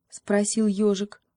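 ordinary delivery, nothing marked

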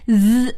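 "z" as a sustained buzz, tongue tip between the teeth; isolated friction sound. Shown as a demonstration. Teacher demonstrating the ending to students, "z" buzzed long. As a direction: hold "z" as voiced friction, no vowel. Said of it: "把舌尖儿这个伸到上牙齿和下牙齿中间".

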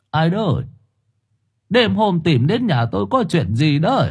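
put-on high-pitched old man's questioning; puzzled elderly man woken late at night; man of seventy. A narrator's reading. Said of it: "Một người đàn ông độ bảy chục".

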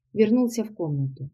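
plain reading voice

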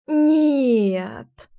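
long stretched-out no; drawn out. A narrator's reading. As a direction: drag the word out. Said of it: "тянула она".

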